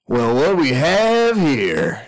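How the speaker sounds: drawn-out, swooping villain greeting; slow, gloating character voice; gloating gang boss. Acted. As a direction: stretch it out as the crook finding his prey. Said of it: "there's Tula and Simon".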